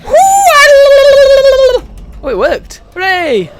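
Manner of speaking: high, loud delighted shouts; excited triumph. Real, not acted. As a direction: cheer like you just won. Attack works.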